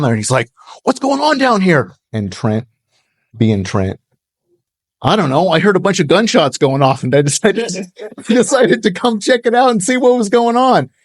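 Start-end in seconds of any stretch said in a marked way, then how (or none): 0.8-1.9 pitched way up, a sharp question; a neighbor pulling up, suspicious, voiced high; suspicious local. Neighbor drives up, challenges them. 5.0-10.9 higher, playing innocent in a Southern drawl; good old boy Southern innocence, laughing through it; Southern drawl charm. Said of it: "does the good old boy Southern drawl charm".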